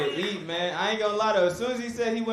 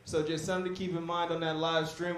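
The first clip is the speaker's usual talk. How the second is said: ordinary delivery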